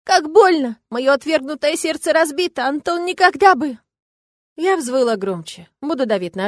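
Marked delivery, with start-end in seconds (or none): 0.0-3.8 hammed-up high wail; loud complaint. A narrator's reading. Woman presses for pity and jealousy, loud lament. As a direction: wail theatrically to win pity. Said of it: "громко пожаловалась я".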